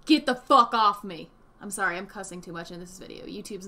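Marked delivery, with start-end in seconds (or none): 0.1-1.3 angry rebuff. Acted.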